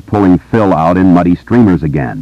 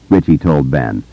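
ordinary delivery

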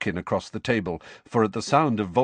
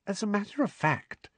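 sugary, high and sweet; falsely sweet and lilting; sweetly; 12 semitones higher, 5 dB quieter than usual. A narrator's reading. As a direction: coat the jab in sugar. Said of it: "said Hermione sweetly".

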